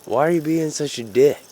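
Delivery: high, loud mock protest; re-enacted shout of complaint; retold indignant call-out. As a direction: call up high to a cop in his car.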